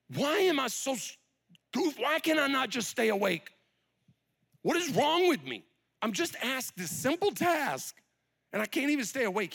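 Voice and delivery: high-pitched, exasperated self-scolding; frustrated with himself. Acted. Peter asking why he cannot stay awake, frustrated. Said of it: "Peter's frustration with himself".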